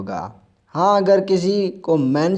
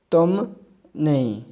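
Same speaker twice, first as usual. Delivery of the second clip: normal talking voice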